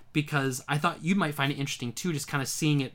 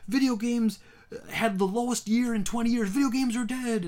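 high, dramatic proclamation; doom-saying headline; 9 semitones higher, 4 dB louder than usual. Retold or imitated. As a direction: announce it like the end of the world.

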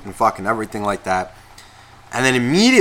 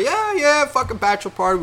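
high, bright put-on "yeah yeah"; mock excited reply; 12 semitones higher than usual. Friend answering the crowd, mock excited. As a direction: toss it off in a jokey high voice.

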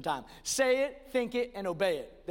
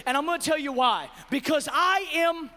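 raised, driving sermon climax; loud, high, emphatic proclamation; full-force preaching; 7 semitones higher, 7 dB louder than usual. Real, not acted. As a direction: preach it with rising force to a shout.

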